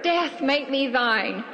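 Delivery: slow, hammed-up tragic plea; tragic heroine sorrow. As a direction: beg for death like a stage heroine. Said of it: "that's a little melodramatic".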